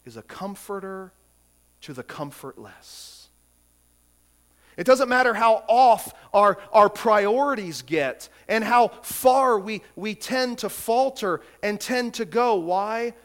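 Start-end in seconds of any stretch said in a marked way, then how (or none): 4.8-13.1 loud, high fervent preaching; impassioned preaching. Acted. Pastor preaching grace to his congregation, loud and fervent. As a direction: build the sermon to full force.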